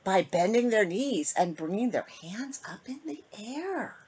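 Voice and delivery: put-on lilting rhymed read-aloud for kids; storybook reading. A narrator's reading. Host reading a bedtime rhyme to kids, lilting. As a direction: read the rhyme to children, sing-song. Reads picture book to children.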